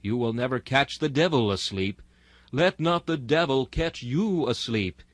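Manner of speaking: usual speaking voice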